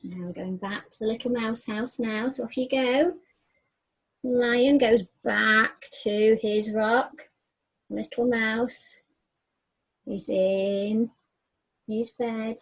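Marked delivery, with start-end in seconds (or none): 4.2-12.6 slow, hushed bedtime-story reading; story narration. A narrator's reading. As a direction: draw the children into the night scene.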